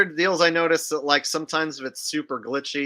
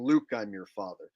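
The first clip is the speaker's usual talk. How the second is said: quieter wrong version; 7 semitones lower, 6 dB quieter than usual. Shown as a demonstration. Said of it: "rather than".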